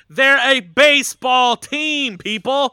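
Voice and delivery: loud, slow, exasperated "they're a baseball team, people"; exasperated retort. Retold or imitated. Mocking critics with a loud, slow exasperated retort. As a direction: spell it out loudly and slowly, fed up.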